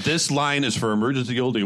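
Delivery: normal talking voice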